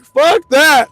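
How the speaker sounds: high, loud exclamation; shouting while running from the yard; panicked outburst. Retold or imitated. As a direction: yell it as you bolt. Retold decision to run off.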